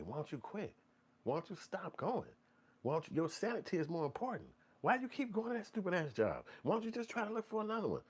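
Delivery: high, soft pleading advice; concerned friends. Retold or imitated. Family urging a complainer to quit, high and softly pleading. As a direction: coax them gently to quit that job.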